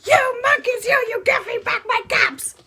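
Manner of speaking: high loud furious peddler; shouted. Acted. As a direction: yell at the monkeys at full force. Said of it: "He stomped both his feet and shouted".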